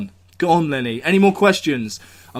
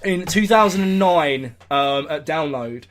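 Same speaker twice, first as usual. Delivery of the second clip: normal talking voice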